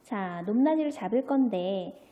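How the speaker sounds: normal talking voice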